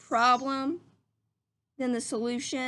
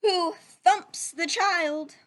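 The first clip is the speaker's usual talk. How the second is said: slow, louder dramatic reading; building storybook suspense; 4 dB louder, slower than usual. Acted. Teacher reading the chaotic ending to the class, slow and dramatic. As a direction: build each line like a chain of mishaps. Dramatic climax of the read-aloud.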